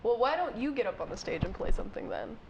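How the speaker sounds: ordinary talk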